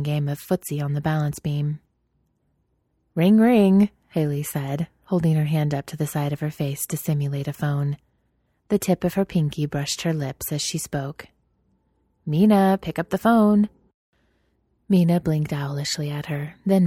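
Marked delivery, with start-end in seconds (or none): 3.1-3.9 ringing like a phone; mock phone ring. 12.3-13.7 raised, calling voice. Acted. Calling into pretend phone.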